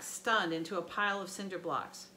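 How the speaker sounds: plain reading voice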